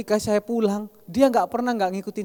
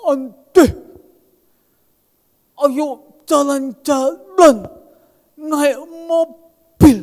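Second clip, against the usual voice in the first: put-on high, loud, very slow childlike voice; insistent childlike plea to go out; Wahyu begging; 7 semitones higher, 9 dB louder, much slower than usual. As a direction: drag out the words like an eager child pestering. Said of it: "Orangnya kan memang ngomongnya kayak gitu".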